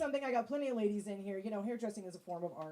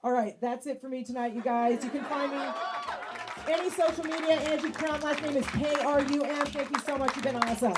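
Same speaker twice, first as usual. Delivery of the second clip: calling out louder over the crowd; booming thank-you to the audience; loud sign-off; 5 semitones higher, 8 dB louder than usual. Real, not acted. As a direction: project the goodbye to the back of the room.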